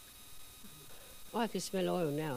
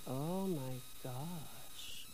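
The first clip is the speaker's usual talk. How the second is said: hushed dismay; 6 semitones lower, 10 dB quieter, much slower than usual. Retold or imitated. Hearing boss steals petty cash.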